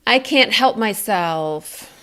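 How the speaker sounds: "I can't help myself" slowly on one breath, pitch falling to the end; falling inflection. Shown as a demonstration. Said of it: "it was on a falling inflection".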